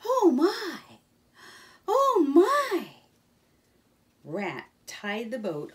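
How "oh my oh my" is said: high, loud breathless gasp of wonder; gasping Mole. Acted. The riverbank's beauty makes Mole gasp, high and loud. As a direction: Mole gasps in delight at the view.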